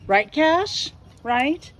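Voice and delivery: asking Cash to agree, high and playful. Real, not acted. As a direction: tease the dog for agreement. Asking the dog.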